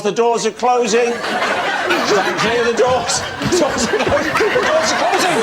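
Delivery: rising urgent announcement. Pretend. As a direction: announcement building in pitch and urgency. Announcer building up.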